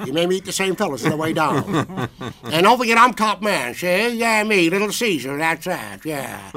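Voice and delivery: high gangster voice, cigar in mouth; Edward G. Robinson impression. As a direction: swagger like Little Caesar. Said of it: "I did an imitation of Edward G. Robinson".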